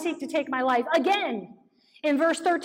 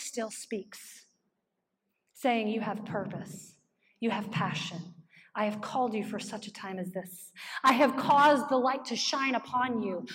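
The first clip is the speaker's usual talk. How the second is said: still small voice; 7 semitones lower, 6 dB quieter than usual. Acted.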